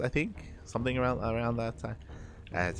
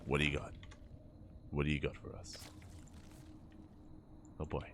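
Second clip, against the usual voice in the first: quiet musing; 9 semitones lower, 17 dB quieter, faster than usual. Real, not acted. Caster musing quietly to himself.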